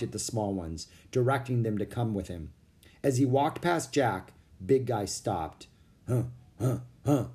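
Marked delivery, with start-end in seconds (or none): 6.1-7.3 low gorilla grunts. Acted. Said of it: "he said in a low voice".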